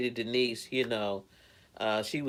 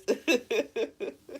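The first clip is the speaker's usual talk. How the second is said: giggle; 11 semitones higher than usual. Real, not acted. Laughing after greeting.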